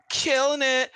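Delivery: high, loud echoed chant; backup girls echo. Acted. Backup girls echo the singer, high and loud. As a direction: chime in like backing singers. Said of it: "all the girls on the back are saying".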